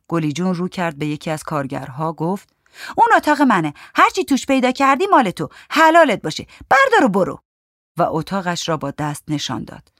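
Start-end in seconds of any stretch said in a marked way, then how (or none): 3.0-7.4 high, loud, defiant offer; defiant woman. A narrator's reading. Woman telling workman to take everything, defiant. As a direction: fling it out in a high, raised voice.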